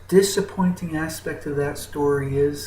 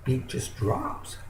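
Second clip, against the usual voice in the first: usual speaking voice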